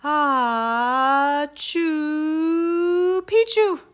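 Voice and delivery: high, loud sneezing squeal of the password; half sneeze. Acted. Dog sneezes password into Chihuahua's ear, high and loud. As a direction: sneeze the words out slowly. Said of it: "half sneezed, half spoke".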